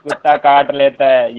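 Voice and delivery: giggling through the words; cracking up mid-joke; laughing. Real, not acted.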